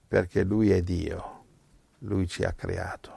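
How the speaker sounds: ordinary delivery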